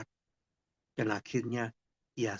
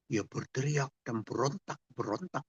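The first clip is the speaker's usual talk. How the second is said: ordinary talk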